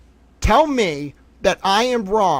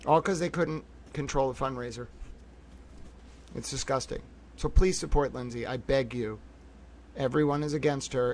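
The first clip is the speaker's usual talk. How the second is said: softer, subdued and grave; forced calm; 2 semitones lower, 9 dB quieter than usual. Real, not acted. To the viewers asking for support, quiet restrained anger. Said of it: "I'm gonna calm down".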